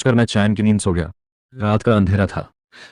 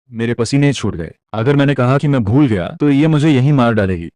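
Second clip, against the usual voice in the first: plain reading voice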